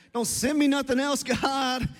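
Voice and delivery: loud, mock-fed-up plea; exasperated protest. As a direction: protest loudly to God, half joking. Acted protest to God.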